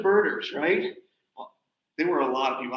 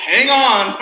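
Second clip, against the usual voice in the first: driver's warning; 6 semitones higher, 11 dB louder than usual. Retold or imitated. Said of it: "He's like".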